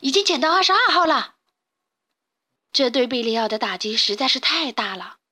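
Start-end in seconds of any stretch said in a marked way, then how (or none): 0.0-1.4 high, loud alarm call. Acted. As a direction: shout the warning across the yard. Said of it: "鼻涕虫喊道".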